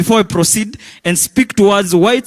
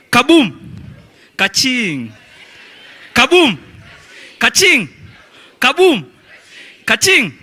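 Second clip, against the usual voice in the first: higher, punchy call and response; hyped crowd warm up; 5 semitones higher than usual. Shown as a demonstration. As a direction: fire off the calls. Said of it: "when I say "Kabumbala," you say".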